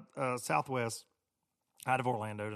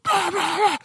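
much higher and louder babble of the yelling man; mock yelling; 11 dB louder than usual. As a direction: imitate distant shouting gibberish. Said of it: "a voice going louder than it should be".

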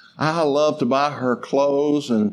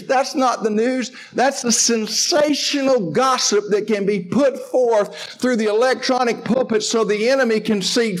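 preaching at full force; 6 semitones higher than usual. Acted. Pastor warning his church about fear, full preaching force. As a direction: drive the point home to the pews.

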